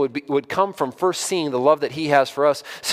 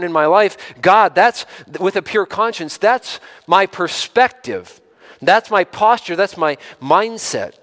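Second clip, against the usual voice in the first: higher and louder, driving the point home; impassioned pulpit delivery; full-force preaching; 5 semitones higher, 5 dB louder than usual. Acted. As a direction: preach it with rising fervour to the congregation.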